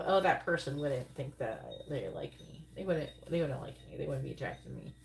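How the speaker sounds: a hint of whiny self-pity; mock insecure self-talk. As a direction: mumble it like a self-doubting inner voice. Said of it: "Why do you say to yourself".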